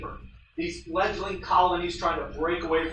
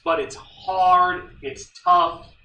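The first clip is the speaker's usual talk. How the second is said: strained emphasis; 8 dB louder, slower than usual. Real, not acted.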